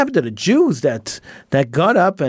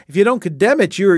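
normal talking voice

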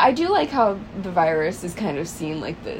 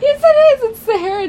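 loud insistence; 7 dB louder, faster than usual. Real, not acted. Insisting loudly.

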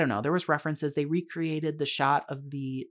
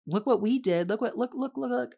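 high, smug look-at-us nagging; mocking movie voice; 9 semitones higher than usual. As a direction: needle them like a show-off movie. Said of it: "very passively said references".

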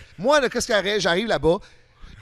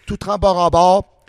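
louder, slow emphatic repeat; 9 dB louder, slower than usual. Retold or imitated. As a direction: hammer it home, slow and loud. Soaked with fear, repeats louder.

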